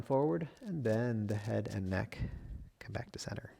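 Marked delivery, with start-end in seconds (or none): 2.8-3.5 soft whispered cue. Real, not acted. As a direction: breathe the words out unvoiced. Yoga teacher guiding class.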